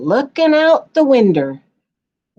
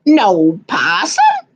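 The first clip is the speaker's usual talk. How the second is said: louder, slow puzzled question; surprised question; 3 semitones higher, 5 dB louder, slower than usual. Acted. Pappy asks in surprise, louder and slow. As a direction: ask it in disbelief.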